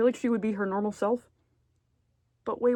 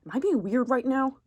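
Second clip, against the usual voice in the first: high anxious mimic of a nervous friend; mock nervous Sota; 7 semitones higher, 5 dB louder than usual. Friend nervously checking if he's weird. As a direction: squeak it out like a self-conscious guy. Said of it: "he'd be like".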